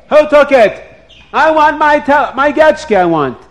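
high, loud frantic scream. Acted. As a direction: shriek it like a man robbed. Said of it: "he started to scream".

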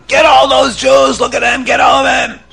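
high shouted mob cry; screaming mob. Acted. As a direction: roar it like an angry crowd. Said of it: "all the Goyim start screaming".

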